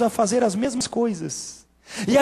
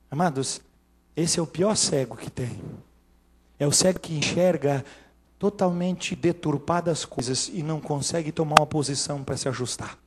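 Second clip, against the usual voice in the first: solemn earnest; 8 semitones lower, 6 dB quieter than usual. Real, not acted. Preacher confiding gravely to the congregation, softly. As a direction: lower and much softer, grave.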